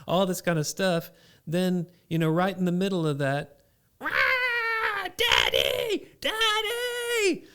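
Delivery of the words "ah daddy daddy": put-on high, shouting child's voice; toddler calling. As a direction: yell for Daddy like a little kid.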